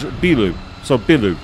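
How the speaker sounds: "bilu" with a short, quick first vowel. Shown as a demonstration. Teacher giving the word for building, short vowel. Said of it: "You extend the B sound".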